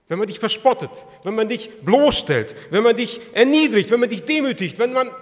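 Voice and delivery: loud, high, hammering list; impassioned preaching. Real, not acted. Preacher confronts listeners with Jesus' humiliation, impassioned. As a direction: drive each clause home at full force.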